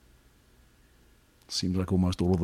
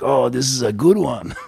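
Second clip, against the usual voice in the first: loud, higher Italian-buddy impersonation; hammed-up, not quite Italian accent; attempted Italian accent; 4 semitones higher, 5 dB louder than usual. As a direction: badly imitate an Italian friend's accent. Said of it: "I can't even do his accent".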